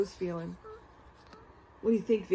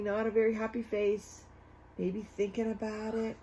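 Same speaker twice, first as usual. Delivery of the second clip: plain reading voice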